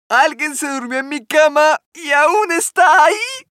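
put-on baby bear yells in alarm; worried cub shouting. A narrator's reading. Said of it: "gritó el osito preocupado". (grito agudo y fuerte del osito preocupado)

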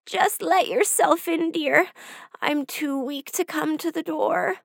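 a lightly voiced sickly grandmother; frail grandmother. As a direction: sound too weak to get up. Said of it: "he called".

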